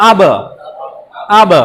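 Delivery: আব with ব bounced off the lips; echoed ab. Shown as a demonstration. Teacher demonstrating qalqala on আব. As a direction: pop the ব of আব from the lips. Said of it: "আমরা ঠোঁটের থেকে প্রতিধ্বনি করব".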